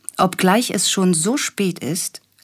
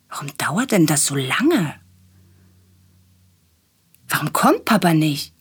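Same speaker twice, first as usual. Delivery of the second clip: plain reading voice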